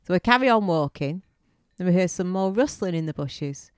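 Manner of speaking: ordinary delivery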